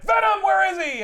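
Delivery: high, loud acted yell; retold shout. Spider-Man swings in demanding an answer, shouting. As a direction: belt it out as the hero bursting in.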